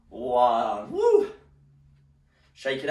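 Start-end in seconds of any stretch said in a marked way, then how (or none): 0.9-1.3 high, loud celebratory whoop; a real joyful woo; excited whoop. Real, not acted. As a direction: cheer the class through the finish. Cheering end of round.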